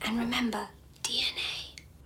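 secretive whisper; hushed, teasing whisper. Acted. Sister teases with a parting secret.

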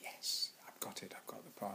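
hushed thrilled whisper; breathless whisper on getting the part; excited whisper. Retold or imitated. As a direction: whisper it like a secret triumph. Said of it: "So you go".

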